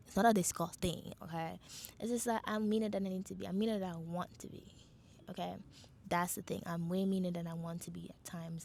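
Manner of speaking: ordinary delivery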